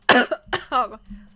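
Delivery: harsh choking coughs; coughing fit. Real, not acted. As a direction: cough hard after swallowing wrong. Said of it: "C'est dur de boire".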